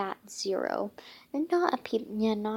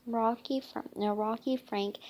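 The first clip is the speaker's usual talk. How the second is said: plain reading voice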